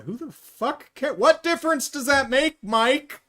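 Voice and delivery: exasperated outburst. Real, not acted. Reviewer yelling at Mike, incredulous exasperated cry. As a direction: vent disbelief at the screen.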